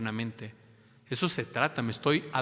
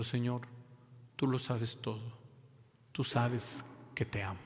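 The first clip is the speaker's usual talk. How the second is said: soft dying words; 8 dB quieter than usual. Acted. Crucified Peter's dying words.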